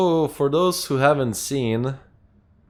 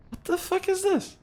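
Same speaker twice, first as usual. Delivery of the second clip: shocked; 3 dB quieter than usual. Real, not acted. Sudden weird game event.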